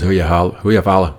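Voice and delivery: put-on loud, quick Irish kid asking; kid's Irish accent. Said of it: "one of the kids after says".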